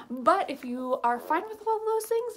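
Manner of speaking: normal talking voice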